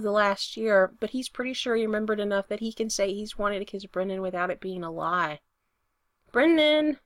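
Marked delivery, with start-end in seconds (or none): 6.3-7.0 higher, louder call of the name; yelling. A narrator's reading. Spencer to Brendan, who isn't answering, yelling. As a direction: yell to get his attention. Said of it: "he yells".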